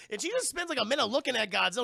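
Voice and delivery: ordinary talk, nothing marked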